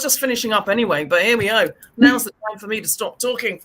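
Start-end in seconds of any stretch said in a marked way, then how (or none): none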